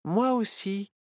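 ordinary delivery

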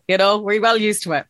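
normal talking voice

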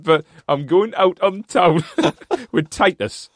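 slow, loud mock accent breaking into laughs; hammed-up imitation of his accent, giggling; Tommy Miller's accent. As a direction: keep his accent going, laughing through it. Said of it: "in his accent as well?".

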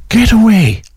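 high, amazed get away; surprised disbelief. Real, not acted. Host hearing of years without break, amazed. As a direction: jump up in disbelief. Host reacts to few weeks off.

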